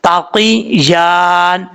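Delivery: slow melodic recitation; slow tajweed chant; recited correctly. Shown as a demonstration. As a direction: chant the phrase slowly. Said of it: "इस तरीके से".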